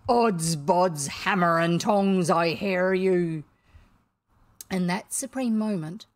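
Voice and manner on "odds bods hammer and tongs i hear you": loud, slow pirate-captain voice; mimicking Hook. A narrator's reading. Boy answers captain in his voice, mimicking. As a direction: boom back as the captain's double. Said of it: "answered in Hook's voice".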